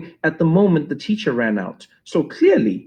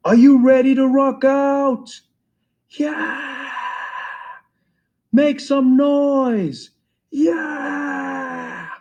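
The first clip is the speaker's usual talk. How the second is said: hype man shout; 10 semitones higher, much slower than usual. Retold or imitated. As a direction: pump up the crowd like a hype man. Hyping crowd on the mic.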